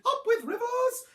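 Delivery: high-pitched, loud duckbill hoot; high honking chant. A narrator's reading. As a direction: hoot it out like a cheering dinosaur. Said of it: "they hooted".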